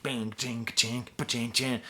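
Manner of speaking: vocalized drum pattern with ringing syllables; imitated drum beat. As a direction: sound out the beat with your mouth. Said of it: "Tom Petty style drum beat".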